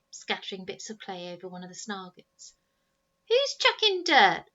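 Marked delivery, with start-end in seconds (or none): 3.3-4.4 loud, high, slow suspicious yell; suspicious shout. Acted. Boy in the pit shouting suspiciously up at his brothers. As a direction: bellow it out, suspicious of everyone. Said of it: "cried the first Snargett suspiciously".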